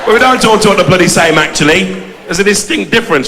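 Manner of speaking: ordinary talk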